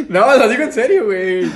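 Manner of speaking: high, loud laugh bursting through the words. Real, not acted. Friend cracks up while insisting he means the praise.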